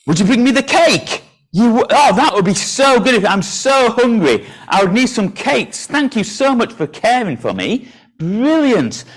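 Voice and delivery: hammed-up hungry gratitude, high-pitched; pretend delight. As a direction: gush over the pretend cake for the children. Pretends delight at being brought cake.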